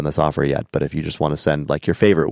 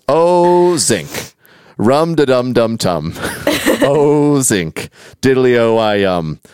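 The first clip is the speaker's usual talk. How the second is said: hammed-up chorus with a long, high "Oh"; rhythmic, drawn-out lyric reading; chorus recitation; 5 semitones higher, much slower than usual. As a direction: chant the nonsense chorus without really singing.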